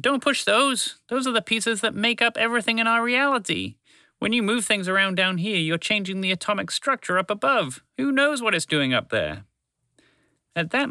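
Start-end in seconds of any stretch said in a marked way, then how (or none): 0.0-9.4 alarmed scolding. Acted. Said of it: "Trevor shouted".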